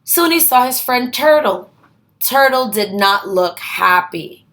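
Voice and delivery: plain reading voice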